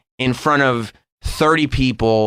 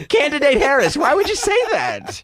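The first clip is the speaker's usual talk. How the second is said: high, incredulous scolding voice; high mock scolding; 11 semitones higher than usual. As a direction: scold her in a high squeaky voice.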